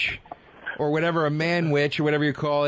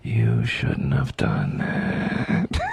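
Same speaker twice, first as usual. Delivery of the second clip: very low, very drawn-out threat; hammed-up villain growing sinister; menacing avenger; much slower than usual. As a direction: stretch every word into a deep, ominous warning. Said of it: "and I just go".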